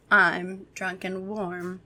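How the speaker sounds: usual speaking voice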